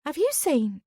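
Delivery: high, rushed and flustered; bursting in all in a fluster; flustered older woman voice. A narrator's reading. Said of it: "looking flustered".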